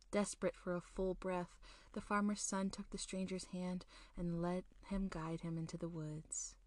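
plain reading voice

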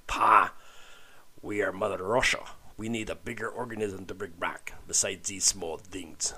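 bad Russian commander accent; a hammy Russian accent. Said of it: "Do I sound like Russian?".

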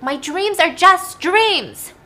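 high, loud, drawn-out acted wail; sobbing scream. Daughter forced to repeat it to dad, high wailed scream. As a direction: wail it slowly as the distressed girl. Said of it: "so she's like".

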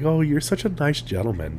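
lightly voiced old lady. Old lady praising him in the waiting room, lightly voiced. Retold old lady's sweet compliment.

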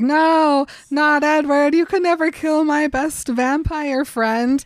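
high, loud, hammed-up wail; mock distraught howling. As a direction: protest like a heartbroken wolf pup, loudly. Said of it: "he starts howling about how sad he is".

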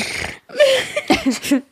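high, helpless laughter; real laugh. Real, not acted. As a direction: break up laughing, high-pitched. Cracks up at the joke.

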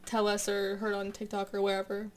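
ordinary delivery

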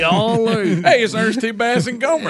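a playful Gomer Pyle "Golly"; Gomer Pyle impression. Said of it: "We sound like Gomer Pyle".

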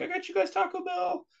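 high-pitched supportive mom voice; sweet mom voice. Retold or imitated. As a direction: chirp it like a cheerful mom. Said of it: "and just be like".

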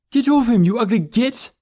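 high, loud scream of rage; full-voiced, shrieked fury; furious scream. A narrator's reading. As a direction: scream at an attacker, anger over fear. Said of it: "Elora screamed, her anger raging above the fear".

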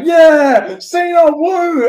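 high, loud whooping cheer; imitated crowd roar. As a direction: cheer like a whole arena of fans. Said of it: "the whole crowd's like".

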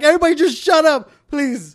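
high, fast plea to a class; exasperated mock yell. Teacher to whole class, exasperated mock yell.

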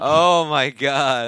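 drawn-out loud "oh my God"; stunned and thrilled, slowly; loud amazed exclaim. Real, not acted. Reacting to gory scene.

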